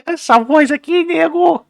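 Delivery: high squeaky rat voice; rubber rat voice. Shown as a demonstration. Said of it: "voz do rato borrachudo pareceu pra caralho".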